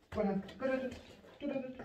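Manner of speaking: high, soft squeaky urging to a dog; excited coaxing. Real, not acted. Owner urging his gun dog to bring the toy, high excited coaxing. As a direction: coax the dog on in a high sing-song.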